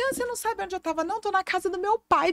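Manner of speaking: high, fast, cheeky talking-back voice; mock rude retort. Woman imitating Jesus sassing his mother, high and cheeky. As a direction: toss it off like a sassy teen answering his mum. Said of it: "desaforadão".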